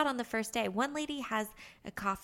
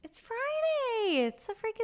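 excited; 13 semitones higher than usual. Real, not acted. Excited Friday cheer.

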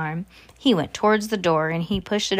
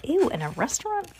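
disgusted whisper; 8 semitones higher, 4 dB quieter than usual. Real, not acted. Reader's disgusted aside.

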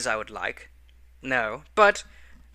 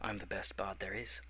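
much softer, lower boast; close confiding boast; 6 semitones lower, 16 dB quieter than usual. Acted. Bard boasting softly to the innkeeper up close, quiet and low. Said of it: "I lean in really closely and I say".

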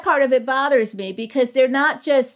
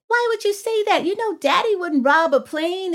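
much higher, a touch of disbelief; taken-aback question; 8 semitones higher than usual. Retold or imitated. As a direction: question the boy, caught off guard. Mother retells questioning her son.

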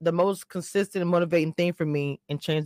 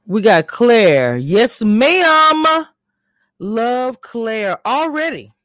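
excited; 6 semitones higher, 8 dB louder, much slower than usual. Real, not acted. Cheering a favourite cast member.

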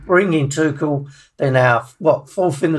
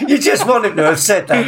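ordinary talk

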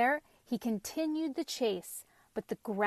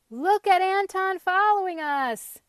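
high, loud gleeful-style yell; 9 semitones higher, 10 dB louder than usual. A narrator's reading. Pumpkin to his vegetable followers, high yell. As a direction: yell it out to the whole crowd. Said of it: "yelled Pumpkin".

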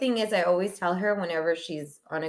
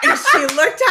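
laughing; 9 semitones higher, 11 dB louder than usual. Real, not acted.

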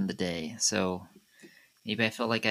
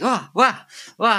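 high, loud panicked yelps; hammed-up scream of someone caught off guard; startled yell; 10 semitones higher, 6 dB louder than usual. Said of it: "They yell. They're like".

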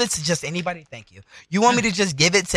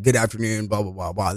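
deep, quiet customer-service greeting; phone voice; 8 semitones lower, 4 dB quieter than usual. Shown as a demonstration. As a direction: put on the work phone voice. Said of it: "This is, this is the phone voice".

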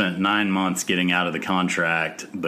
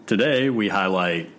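usual speaking voice

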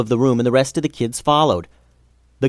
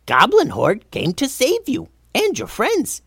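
high, squeaky goblin voice; friendly Goblin King; 10 semitones higher than usual. A narrator's reading. Steve telling Tulsi he came to save them, eager high goblin. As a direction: play an eager little goblin.

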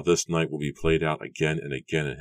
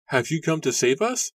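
eager, higher-pitched plea; eager whisper; 12 semitones higher, 3 dB louder than usual. A narrator's reading. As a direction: ask it eagerly, pitched well up. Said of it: "Susanna repeated in an eager whisper".

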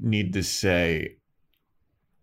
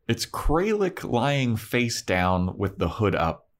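ordinary talk

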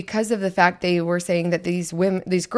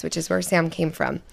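normal talking voice

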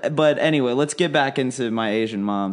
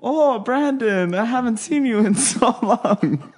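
imitating mom, pitched way up; 10 semitones higher than usual. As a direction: gush like an overjoyed mother.

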